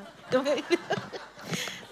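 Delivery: light embarrassed laugh; quick sheepish chuckle; short laugh. Real, not acted. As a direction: laugh at her own admission. Self-deprecating aside.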